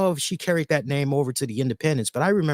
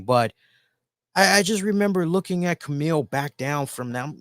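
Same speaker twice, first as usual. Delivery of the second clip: normal talking voice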